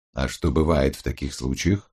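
low, curious criminologist voice; Гаран. A narrator's reading. Criminologist asks what happens in such cases, low and curious. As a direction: drop low and ask with calm curiosity. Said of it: "полюбопытствовал Гаран".

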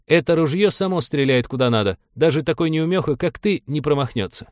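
high-pitched quoting back of a boast; aggrieved, high navigator voice; indignant retort. A narrator's reading. Navigator throws back pilot's boast.

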